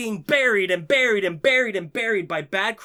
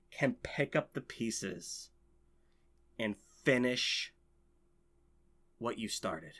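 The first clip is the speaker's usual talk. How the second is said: slowing, sinking much lower and softer; slow solemn hush, trailing off; hushed reverent fade; 11 semitones lower, 10 dB quieter than usual. Real, not acted. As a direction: let it fade away with reverence. Tribute to dead wrestler.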